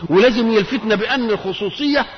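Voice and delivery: normal talking voice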